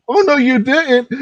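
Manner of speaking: very high, drawn-out mock outrage; put-on squeaky indignant cat; sassy cat. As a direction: voice an offended cat, slowly and way up high. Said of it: "you know, that cat look, like".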